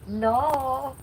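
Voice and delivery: drawn-out, rising mock 'no'; universe's refusal. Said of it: "the universe was like".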